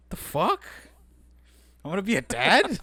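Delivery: high, loud retold shock; incredulous yelp, pitch leaping up at the end; shocked disbelief. Man waking to news he'll be a dad, acting out shock.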